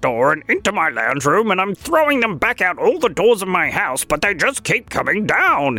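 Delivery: a sleepy cranky little critter; gopher voice. Acted. Held gopher voice.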